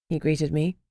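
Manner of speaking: ordinary delivery, nothing marked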